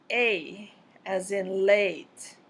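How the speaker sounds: drawn-out example sounds; slow vowel demo. Shown as a demonstration. Teacher slowly modeling diphthongs for learners. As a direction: stretch each example out slowly. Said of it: "single sounds or diphthongs".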